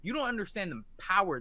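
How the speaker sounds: usual speaking voice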